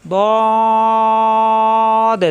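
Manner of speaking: teacher reciting দদ with full four-alif stretch; long drawn dad. Shown as a demonstration. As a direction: chant দদ for four beats, then bounce the last দ. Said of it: "চার আলিফ পরিমাণ টান বানান করলে".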